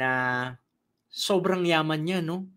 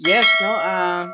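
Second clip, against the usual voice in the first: usual speaking voice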